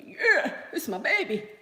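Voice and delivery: high, loud proud brag; proud boyfriend. Retold or imitated. Boyfriend boasting to his colleagues, high and loud. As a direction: show her off loudly like a proud man. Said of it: "he was like".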